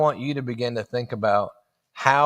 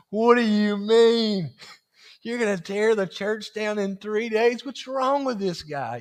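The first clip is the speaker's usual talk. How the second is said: baffled Pharisees; 6 semitones higher than usual. Retold or imitated. Pharisees reacting to Jesus, stunned high disbelief. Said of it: "their minds were just smoking".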